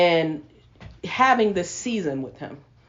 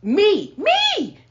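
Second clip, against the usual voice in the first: me, me! pitched way up on the second; a rising, incredulous me, me; emphatic me; 10 semitones higher, 3 dB louder than usual. Real, not acted. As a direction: cry out me, me in disbelief at yourself. Emphatic self-confession.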